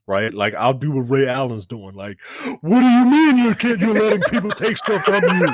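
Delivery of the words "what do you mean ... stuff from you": raised voice. Retold or imitated. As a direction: let the disbelief blow up at him. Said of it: "but I will raise my voice".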